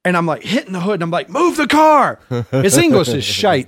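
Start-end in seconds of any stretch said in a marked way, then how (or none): 1.3-2.2 retold yell. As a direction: shout it like your foot is pinned. Yelling at driver over foot.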